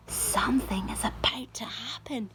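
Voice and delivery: hushed whisper to himself. A narrator's reading. James whispering to himself under the tree. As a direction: breathe it out in awe. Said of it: "he told himself".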